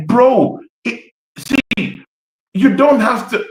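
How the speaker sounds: exasperated outburst. Real, not acted. Fan ranting about Arteta's tactics, pitch jumping up in exasperation. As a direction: throw up your hands at the tactic.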